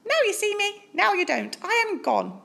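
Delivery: a small bright-eyed mouse piping up; tiny mouse voice. Acted. Polite mouse asks Bear for cheese, squeaky high.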